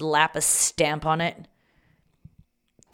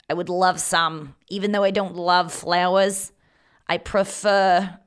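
drawn-out, shaky attempt at Australian; Australian accent; 4 dB louder than usual. Confessing flower tastes to Matt, mock Australian accent. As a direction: stretch the vowels in a fake Australian accent. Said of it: "I got Australian on that".

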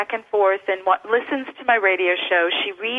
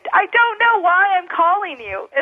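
much higher, slow, bewildered caller voice; puzzled caller; 10 semitones higher, slower than usual. Retold or imitated. Callers phoning a stranger, said in a high, slow, baffled voice. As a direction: play a baffled stranger who can't say why they rang.

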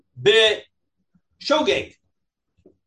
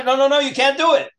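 stern rabbi; 7 semitones higher, 4 dB louder than usual. Retold or imitated. Rabbi voiced forbidding in story.

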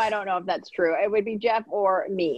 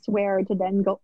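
ordinary talk, nothing marked

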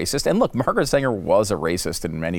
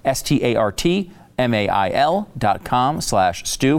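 spelled out slowly; slower than usual. Shown as a demonstration. Spells out URL.

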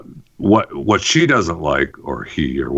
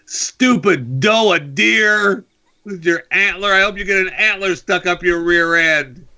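mock gamer insult; 11 semitones higher than usual. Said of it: "But if I say".

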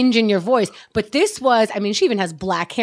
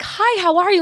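high, head-tilted sweet hello; sweet greeting; 10 semitones higher, 3 dB louder than usual. Retold or imitated. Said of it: "tilts her head and's like".

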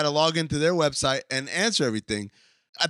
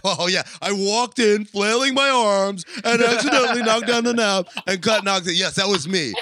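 louder, singsong sarcastic confession; louder, half-sung mock confession; over-the-top sarcasm; 4 semitones higher, 4 dB louder than usual. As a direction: ham up the admission so nobody believes it. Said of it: "through my very sarcastic tone".

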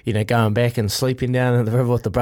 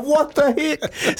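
high, drawn-out comic shock; hammed-up astonishment, slow and high; mock startled disbelief; 14 semitones higher, much slower than usual. Brother spots the kid under water, mock shock.

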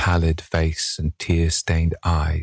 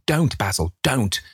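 pleading cry; 6 semitones higher than usual. A narrator's reading. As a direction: cry out to stop him, pitch rising. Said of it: "he cried".

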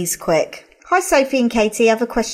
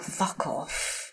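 whispered swear, much softer; under my breath; 7 semitones lower, 17 dB quieter than usual. Retold or imitated. Host shows how she swears at rude fans, under her breath. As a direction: mutter it barely aloud. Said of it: "Under my breath".

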